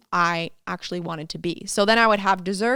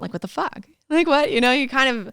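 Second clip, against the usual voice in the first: ordinary talk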